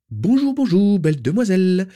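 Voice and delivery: very high, loud sing-song greeting; bubbly tiny woman popping up; chirpy little shopkeeper. Acted. Shopkeeper greeting Narcissa, high and chirpy.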